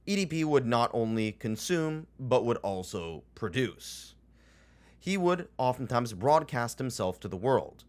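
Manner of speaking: ordinary delivery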